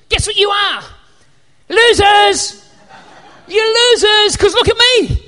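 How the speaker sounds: gleeful mock gloating; mock taunt. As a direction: jeer at them like a playground bully. Preacher mocking cockroaches as losers.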